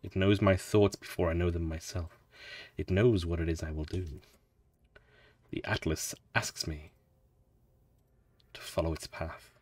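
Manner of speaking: hushed, low, measured reading that fades; solemn story narration. Acted. Traveler musing on the Atlas's commands, solemn and hushed. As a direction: read it as a quiet, reverent inner monologue. Reads game text solemnly.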